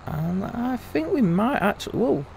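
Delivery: surprised whoa. Real, not acted. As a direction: pitch jumps up on a startled whoa. Sudden surprise in the game.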